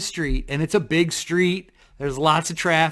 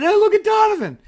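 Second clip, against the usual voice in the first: very high, jeering call; mock call-out; 5 dB louder than usual. Buddy spotting him staggering, high jeering call. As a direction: tease him like a buddy in a bar. Friend calling out mockingly.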